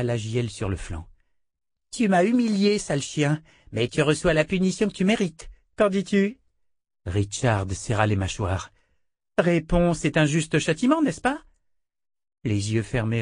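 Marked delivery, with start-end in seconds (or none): 1.9-6.4 high, mocking little-girl voice; cruel child. A narrator's reading. Child princess taunting her captive Richard, high sneering voice. 9.4-11.4 high, mocking little-girl voice; cruel child. A narrator's reading. Child princess taunting her captive Richard, high sneering voice.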